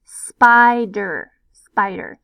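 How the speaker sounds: spider with a long I, spy-der, first one loud. Shown as a demonstration. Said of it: "making the vowel long".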